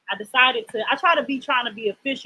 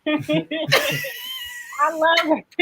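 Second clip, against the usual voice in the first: laughing delight; 7 semitones higher, much slower than usual. Real, not acted.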